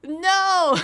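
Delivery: high, loud flat refusal; emphatic no. Real, not acted. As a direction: slam the door on it.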